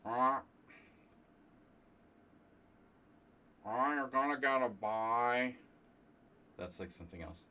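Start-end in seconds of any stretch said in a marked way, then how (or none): 0.0-5.5 higher, louder, slow put-on official voice; tournament director impression. Streamer imitates a famous tournament director handing out a bye, mock official. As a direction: announce it like a droning old tournament director. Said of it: "the most famous tournament director in the United States".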